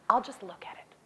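whispered, talking herself into it; sneaky little whisper to herself; tempted whisper. Retold or imitated. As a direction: whisper it like a guilty excuse. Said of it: "I was like".

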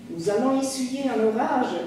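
animated, mildly alarmed exclamation; exclaimed alarm. A narrator's reading. Landlady warning of the coming storm, mild exclamation. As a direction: voice the landlady's dismay with some lift. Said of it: "s'écria ma fermière".

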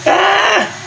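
sudden high-pitched yelp; a sharp cry of surprise; startled yelp. Real, not acted. Sudden yelp while cooking.

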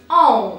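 "О" as a nasal o, voice dropping down; nasal Polish vowel. Shown as a demonstration. Teacher to learner, demonstrating "О" as a nasal vowel. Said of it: "вимовляється вона так трішки вниз".

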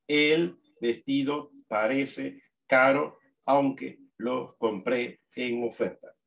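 slow dictation reading; dictado lento. Shown as a demonstration. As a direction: read each word slowly so the class can write it. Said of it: "va a leer en esta forma". (el maestro dicta la oración despacio)

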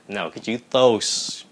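ordinary delivery

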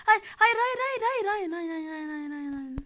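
panicked screaming no. Real, not acted. Character about to be caught.

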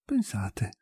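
breathy unvoiced whisper. A narrator's reading. Nadiezhda to Maria, hushed whisper.

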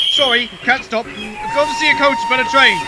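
hurried. A narrator's reading. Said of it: "he went on hurriedly".

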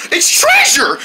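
high, thrilled squeal; giddy, pitch shooting up; excited discovery. Acted. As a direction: show off your find in high-pitched glee. Shows off found treasure.

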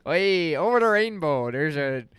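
loud, high hammed-up Irish accent. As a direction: belt out a cartoonish Irish lilt. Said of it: "You got a good Irish accent?".

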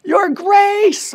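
high, loud, drawn-out cry to God; sustained high shout, phrase by phrase; screamed prayer. Retold or imitated. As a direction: scream each phrase so a distant helper hears. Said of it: "I screamed them, hoping that my helper".

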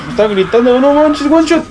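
high-pitched excited panic; alarmed. Real, not acted. Gamer facing a screaming Cantus, alarmed.

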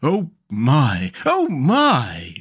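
lightly acted gasp of awe; wide-eyed amazement in a story voice; gasping wonder. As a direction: marvel aloud, the pitch swooping. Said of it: "still gasping".

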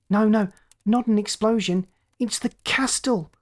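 high, mocking taunt over the radio. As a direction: jeer the news at them. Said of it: "the lookout's voice taunted".

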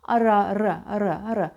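"ara" with a minimal tongue flutter; short repeated "ara", softly tapped R; light R. Shown as a demonstration. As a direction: flick the R just once in "ara". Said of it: "Çok hafif titrer".

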